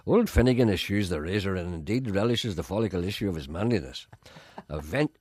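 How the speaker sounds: pompous poetry reading; mock grand recital. Shown as a demonstration. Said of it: "here's my Nobel Prize version".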